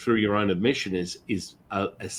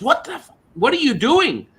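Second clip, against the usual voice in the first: loud, very high shocked demand; freaked-out shriek; 7 dB louder than usual. Retold or imitated. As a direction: imitate a friend freaking out down the phone. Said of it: "She, she freaked out".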